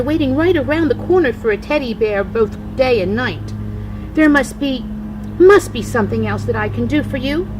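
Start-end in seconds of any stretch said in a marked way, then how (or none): none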